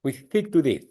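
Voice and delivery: imitating a Spanish friend's lisp; light Spanish accent with a lisp. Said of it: "with the Spanish lisp".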